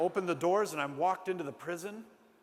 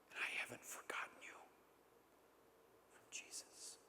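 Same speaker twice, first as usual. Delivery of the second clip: hushed whisper, barely voiced; comforting a trembling prisoner in a whisper; tender whisper; 18 dB quieter than usual. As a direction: whisper it gently to a frightened girl. Tender words to shaking girl.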